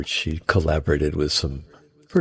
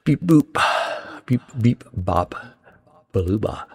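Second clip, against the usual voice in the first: robot beeping; 5 semitones higher, 3 dB louder than usual. Pretend. Mimics phone beeps.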